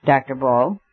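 a firm, insistent call of the name, rising at the end; commanding call. A narrator's reading. Syme cuts into the professor's speech with a commanding call. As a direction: demand his attention, not to be ignored. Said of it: "in a voice that could not be neglected".

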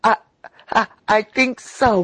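high, stammering mock sob; blubbering. A narrator's reading. Freckles pretending to blubber to the lobbyist. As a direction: blubber it out, faking fear. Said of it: "blubbered Freckles".

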